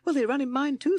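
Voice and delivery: high, loud timid toad voice. A narrator's reading. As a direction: chip in nervously, pitched high. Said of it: "said timid Toad".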